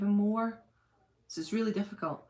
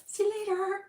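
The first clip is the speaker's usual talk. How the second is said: high, sing-song cheery goodbye; mock cheery exit; 12 semitones higher than usual. Someone escaping a confrontation, high cheery farewell. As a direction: chirp it while backing away. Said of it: "I'm like".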